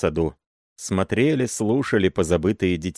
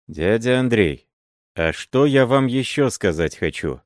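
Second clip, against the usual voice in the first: usual speaking voice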